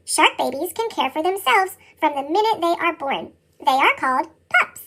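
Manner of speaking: ordinary delivery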